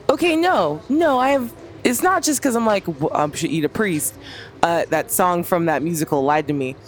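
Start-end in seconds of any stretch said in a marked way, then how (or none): 0.1-4.1 snapping out of daze. Acted. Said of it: "snaps back to herself".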